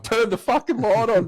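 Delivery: retold urgent command; dead serious. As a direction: demand it urgently, acting out the outburst. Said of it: "give her the level of my fucking seriousness".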